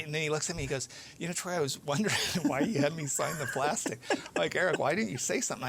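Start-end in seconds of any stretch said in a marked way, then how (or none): 1.2-5.7 real chuckle breaking into the retold exchange; laughing while retelling. Real, not acted. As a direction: tell it through amused laughter.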